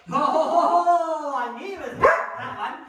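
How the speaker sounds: surprised oh. Real, not acted. Trainer reacting as her dog picks wrong, surprised. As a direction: burst out at the dog's choice.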